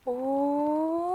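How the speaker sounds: soft rising ooh glide; vocal slide. Shown as a demonstration. Teacher demonstrating a vocal slide for the class, softly. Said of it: "Let's try that".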